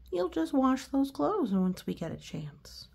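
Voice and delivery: plain reading voice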